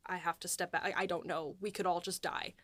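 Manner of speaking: normal talking voice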